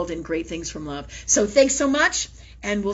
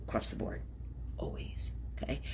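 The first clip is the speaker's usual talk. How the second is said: breathy whisper, much softer; 11 semitones lower, 14 dB quieter than usual. Real, not acted. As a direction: let the last words fade into a whisper. Closing a point softly.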